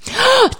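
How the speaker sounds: high excited gasp; sharp gasp. Real, not acted. S0 hearing about the fart gift, gasping. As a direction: gasp in delight.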